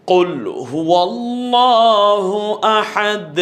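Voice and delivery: slow, high, chanted tajweed recitation; recited Arabic chant; Quran recitation. Shown as a demonstration. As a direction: intone it slowly like a qari. Quran recitation demo in lesson.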